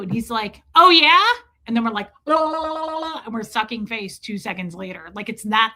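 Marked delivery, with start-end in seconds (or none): 0.7-1.2 loud, high comeback; mock challenge. Couple mock-quarrelling in person, loud challenging comeback. Acted quarrel, partner fires back. 2.3-3.2 high la-la-la babble; mock smooching noise. Couple kissing after a fight, silly high babble.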